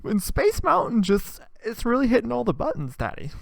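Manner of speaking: put-on high, wailing teenage girl; whiny teen daughter. Daughter wailing to her dad about Blake, high and whiny. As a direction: sob it out like a heartbroken teenager. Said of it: "she's like".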